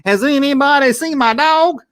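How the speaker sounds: put-on sing-song like a childhood Muppet record; a hint of a Muppet character singing. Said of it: "I remember going around".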